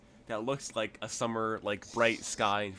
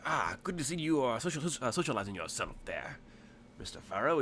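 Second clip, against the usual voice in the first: lightly put-on Cockney shopkeeper; hint of Cockney in a sociable greeting; mild Cockney salesman; Cockney-tinged tailor; 3 semitones higher than usual. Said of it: "he's really Cockney instead of".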